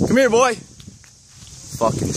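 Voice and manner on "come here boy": high call across the road; calling a dog. Real, not acted. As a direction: call the dog from afar, pitched up.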